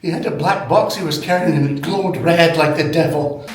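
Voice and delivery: a touch of frightened peasant; mock villager testimony. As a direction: tell it like a scared villager. Mock medieval accuser testimony.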